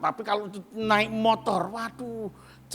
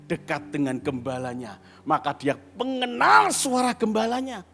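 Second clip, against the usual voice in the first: ordinary talk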